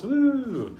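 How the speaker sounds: high, loud "woo"; excited whoop. Real, not acted. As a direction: let out a high cheer. Cheers about hosting the live class.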